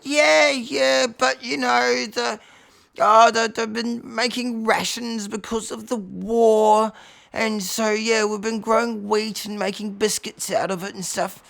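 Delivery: high, loud, drawn-out elder voice; Bob. Acted. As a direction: explain it slowly in a high plaintive wail. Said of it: "He said".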